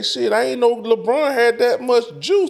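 high mocking voice, stretched at the end; Mace impression. Retelling Mace's podcast take, high mocking impression. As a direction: play him lazy and sure of himself. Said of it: "He say".

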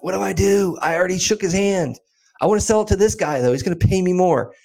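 high-pitched, torn plea for advice; mock agonizing. Retells agonizing to his mentor.

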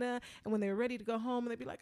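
normal talking voice

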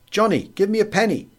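put-on high, wheedling child's plea; a child tugging at a sleeve for a penny; begging child. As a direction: pipe up like a small beggar child.

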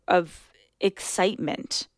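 ordinary talk, nothing marked

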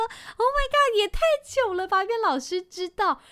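high, loud squeal of embarrassment, laughing; embarrassed squeal. Retold or imitated. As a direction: shriek it like you've been caught out. 被老师发现偷睡的窘迫. (又高又响的尴尬尖叫)

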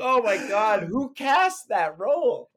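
high, slow outraged squeal; exasperated disbelief. Retold or imitated. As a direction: drag out the disbelief. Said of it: "I'm like".

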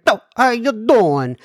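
hammed-up Italian-American Jersey accent; mock New Jersey accent. Shown as a demonstration. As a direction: imitate a New Jersey local. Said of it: "comes to New Jersey".

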